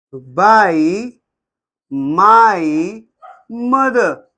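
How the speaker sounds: slow dictation with long pauses between words; spaced out, as if writing along. Shown as a demonstration. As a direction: dictate it one word at a time. Dictating example while writing it out.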